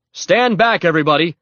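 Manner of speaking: loud, high warning order; yell. A narrator's reading. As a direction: yell to clear the crowd. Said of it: "he yelled".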